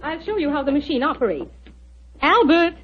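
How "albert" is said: a raised, higher call of the name. Acted. Doctor calls her assistant over to the machine, calling out. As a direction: summon him from the next room.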